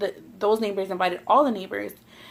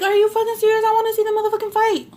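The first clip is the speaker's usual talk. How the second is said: much higher and louder, fast and fired up; incredulous outburst; 12 semitones higher, 4 dB louder, faster than usual. Retold or imitated. Young woman to the neighbor, refusing to go inside, high incredulous outburst. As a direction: blurt it out in high disbelief, no way I'm missing this. Indignant reply to being sent inside.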